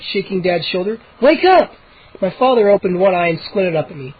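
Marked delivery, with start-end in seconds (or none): 1.2-1.7 much higher, loud; urgent call. A narrator's reading. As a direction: shake him awake. Shaking dad awake.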